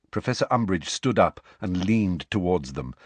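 ordinary delivery

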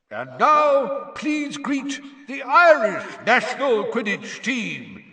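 high, loud stadium announcer; yelled announcement. A narrator's reading. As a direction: hail the team to a roaring crowd. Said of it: "yelled Bagman".

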